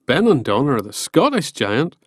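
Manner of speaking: ordinary delivery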